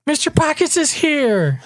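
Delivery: put-on high child voice calling out; excited kid. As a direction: announce the arrival like a thrilled little kid. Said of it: "eruption of screams and laughters".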